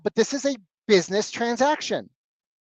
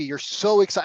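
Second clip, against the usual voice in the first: usual speaking voice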